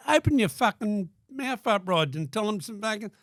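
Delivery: high, loud, slowed jeering heckle; heckling shout. Retold or imitated. Calling out to Rod on stage, loud heckle. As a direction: bellow it at him across the room. Heckling mate during his show.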